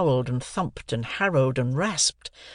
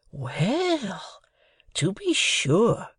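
slow, higher amazed "to be sure"; astounded; 7 semitones higher, much slower than usual. A narrator's reading. Joe astounded at the news. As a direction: let it sink in, astonished. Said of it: "said Joe, astounded".

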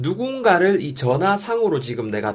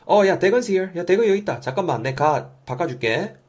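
higher, raised-voice phone exchange; calling out; 7 semitones higher, 3 dB louder than usual. Acted. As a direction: call out that someone is here. Acts out handing over the phone.

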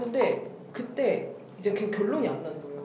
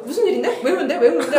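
high, loud, insistent prodding; pushy questioning; 5 semitones higher, 6 dB louder, faster than usual. Retold or imitated. Older sister pestering her crying sibling, loud pushy questioning. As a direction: pester with questions, half laughing.

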